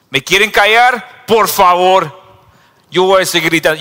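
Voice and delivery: high, loud defiance; loud stubborn shout; defiant cry. Acted. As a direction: refuse to be silenced. Blind man defies the crowd.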